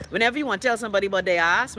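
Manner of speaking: usual speaking voice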